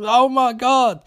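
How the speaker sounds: high, hammed-up exclamation of horror; mock shocked cry. Acting doctor seeing the car explode.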